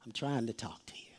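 near-whispered, low urging; hushed plea for attention. Acted. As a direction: drop to an intimate whisper, pleading for attention. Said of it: "he's trying to tell you".

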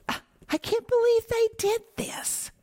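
high, astonished cry of disbelief; disbelieving exclaim. Pretend. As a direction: gasp it out like a stunned onlooker. Imagined onlooker exclaiming in disbelief.

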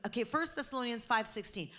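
usual speaking voice

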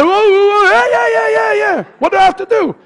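high, eager whooping imitation; mock excitement. Preacher imitating the excited congregation, mock excitement. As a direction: gush like an over-keen congregant. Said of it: "You all were much more excited".

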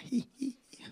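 high mocking giggle, hi hi hi; a thin, high teasing snicker. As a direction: imitate the robber sniggering. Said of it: "börjar han garva".

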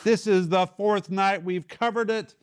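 high, loud playful scolding; mock exasperated. Real, not acted. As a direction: tease them for forgetting after four nights. Teacher teasing students who forgot.

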